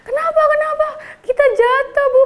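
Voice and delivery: high whiny pretend-hurt voice; fake victim. Child telling the teacher they both fell, high whiny act. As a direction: play the innocent hurt child.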